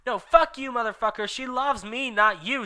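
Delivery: Vampire shouting at Draco; angry shout. Acted. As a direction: hurl it at his rival. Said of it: "shouted Vampire".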